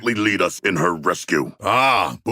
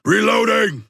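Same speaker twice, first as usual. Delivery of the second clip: battle callout; 12 semitones higher, 3 dB louder than usual. Acted. Combat callout in game.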